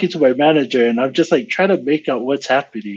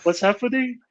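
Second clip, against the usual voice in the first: mock startled string of whoas, pitched up; retold alarm, higher than usual; 4 semitones higher than usual. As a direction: act out being taken aback, starting strong and easing off. Startled by pounding on door.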